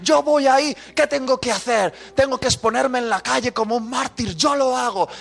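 high, insistent volunteering; acted overzealous willingness; eager offering. Acting out eager offers to God.

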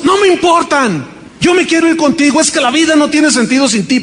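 high, urgent pleading; desperate plea. Retold or imitated. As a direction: insist she wants to go with him. Daughter desperate.

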